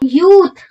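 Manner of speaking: teacher lingering on the long oo; drawn-out long oo. Shown as a demonstration. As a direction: slowly stretch the ऊ in यूथ. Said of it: "यूथ में मेरा sound क्या आ रहा है".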